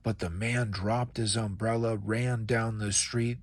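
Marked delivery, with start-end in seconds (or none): none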